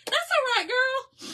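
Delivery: high, lilting hello to a viewer; a high, bright welcome to a friend online; sing-song greeting. Real, not acted. Greeting a viewer on her live, high and sing-song.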